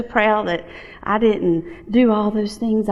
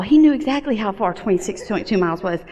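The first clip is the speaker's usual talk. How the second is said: ordinary talk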